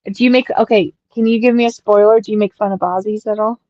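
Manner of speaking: usual speaking voice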